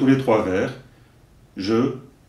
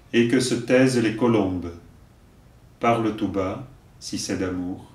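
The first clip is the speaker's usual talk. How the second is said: poem recitation. Acted. Teacher giving verses for learners to repeat, measured recitation. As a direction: recite line by line, leaving room to repeat.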